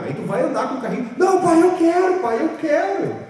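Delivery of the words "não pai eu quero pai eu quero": put-on high, loud whiny child begging; whining child. Child begging dad for a toy, high whiny plea. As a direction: beg like a child who won't take no.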